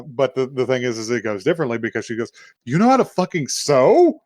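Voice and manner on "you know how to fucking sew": high, loud acted delight; thrilled. She discovers he knows how to sew, acted excitement. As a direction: gush with amazement, jumping up in pitch. Acting girl thrilled that he sews.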